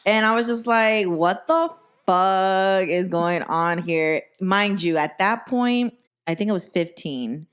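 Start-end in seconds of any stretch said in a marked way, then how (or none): 1.1-4.2 slow, stretched confused question; bewildered teen slowly asking what is happening; drawn-out disbelief. Retold or imitated. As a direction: drag out the disbelief. Slow bewildered retold reaction.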